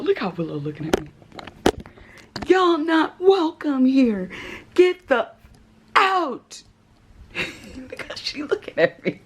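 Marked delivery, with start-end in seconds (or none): 2.5-6.6 slow, higher, firm scolding; telling off roach. Pretend. Ordering the cockroach out of her home, slow and firm. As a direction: lay down the law slowly. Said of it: "telling off roach again".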